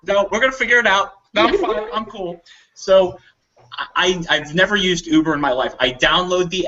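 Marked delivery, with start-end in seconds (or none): 0.0-2.4 high, fast fake reassurance; a higher, hurried fake calm; mock nonchalance. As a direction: pretend everything is fine. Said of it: "I'm like".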